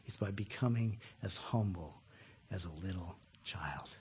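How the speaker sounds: soft reverent whisper; a hushed, heartfelt close to the congregation. Real, not acted. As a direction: let it fade to a hush. Closing whisper.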